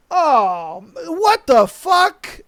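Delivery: slow, high incredulous what the fuck; drawn-out shock. Real, not acted. Host hears about a sexless marriage, slow high shock. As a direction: stretch it out in disbelief.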